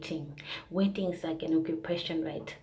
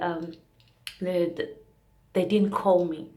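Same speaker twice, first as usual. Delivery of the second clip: usual speaking voice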